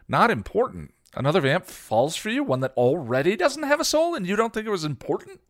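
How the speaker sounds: high, loud accusing tirade. A narrator's reading. Xander accuses Buffy of hiding Spike's love, angry. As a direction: pin her down with blame. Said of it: "Xander accused".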